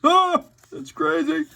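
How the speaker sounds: laughing. Real, not acted. Amused by a dinosaur name.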